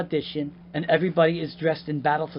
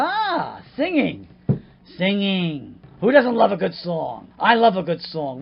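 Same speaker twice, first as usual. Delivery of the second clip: loud, swooping, high mock rapture; hammed-up delight; 4 semitones higher, 8 dB louder, slower than usual. Casting director gushing about songs, mock rapture. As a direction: gush theatrically over singing.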